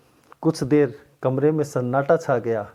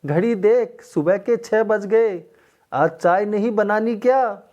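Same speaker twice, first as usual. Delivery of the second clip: scolding shout; 6 semitones higher than usual. A narrator's reading. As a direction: scold her for sleeping late. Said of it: "सास चिल्ला रही थी".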